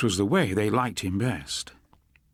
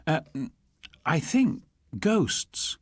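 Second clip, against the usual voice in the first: slow, hesitant and high; unsure; 8 semitones higher, slower than usual. A narrator's reading. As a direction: hang on the words, not sure at all. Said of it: "Charlie looked unsure".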